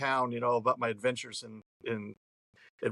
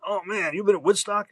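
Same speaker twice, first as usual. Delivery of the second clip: higher, louder eager cabbie; excited cab driver; 7 semitones higher, 5 dB louder than usual. Retold or imitated. Cabbie greets a muddy Woodstock returnee, excited and higher. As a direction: mimic the cabbie's thrilled question.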